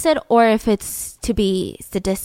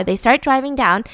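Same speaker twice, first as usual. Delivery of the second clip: normal talking voice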